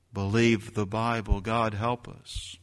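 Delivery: ordinary talk, nothing marked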